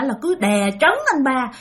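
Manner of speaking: usual speaking voice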